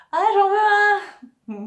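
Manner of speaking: high squeaky penguin toy voice; squawky bouncy penguin. Pretend. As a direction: make the penguin plush beg, squeaky and slow. Toy penguin voiced after laugh.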